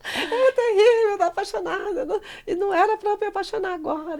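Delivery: very high wail through laughter; hammed-up lovesick whine, high and laughing; laughing mock despair. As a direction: lament it while cracking up.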